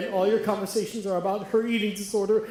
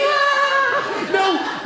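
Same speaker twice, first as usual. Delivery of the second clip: high mock scream; 7 dB louder than usual. Mock panic cry.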